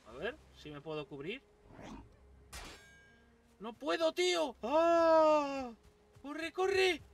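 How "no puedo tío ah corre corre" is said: panicked high yells while fleeing; being chased. Real, not acted. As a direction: scream for your life, running away. Skeleton chasing him, yelling run.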